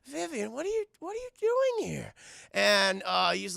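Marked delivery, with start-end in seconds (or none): 0.1-2.1 high, acted bewildered question; puzzled concern. Lewis finds little Vivian in the hallway, acted puzzled concern. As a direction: ask a child with rising, wary surprise. Startled man finds girl at door.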